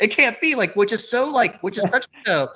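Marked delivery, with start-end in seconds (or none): 0.0-0.5 put-on high-pitched, hammy old comedy line; old-timey screwball disbelief. Jerk facing an unexpected mishap, screwball disbelief. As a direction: imitate a screwball actor, pitched way up. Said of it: "screwball comedy, like, sort of delivery".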